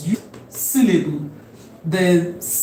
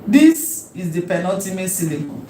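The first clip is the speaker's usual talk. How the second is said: usual speaking voice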